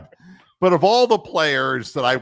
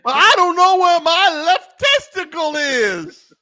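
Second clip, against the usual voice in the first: high loud pained whine; just hit in the groin; 8 dB louder, slower than usual. Pretend. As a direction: whine about lost testicle. Voicing player hit in groin.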